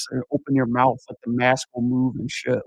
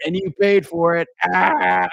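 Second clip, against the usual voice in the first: louder taunting scarer voice; louder mock monster jab; heckling back; 4 semitones higher, 4 dB louder, slower than usual. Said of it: "I looked at him and said".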